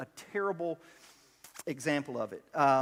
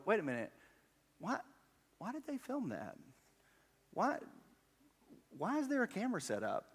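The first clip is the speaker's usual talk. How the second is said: higher, softer mock questioning; puzzled second thought; 6 semitones higher, 5 dB quieter than usual. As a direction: act out dawning doubt, pausing on "why". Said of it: "then I'll stop and go".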